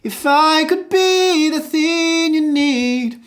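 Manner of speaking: high, slow line peaking on be; stress on be. Shown as a demonstration. Coach singing the full line with the stress on be. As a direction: peak on be and throw away could. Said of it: "aim for the word be".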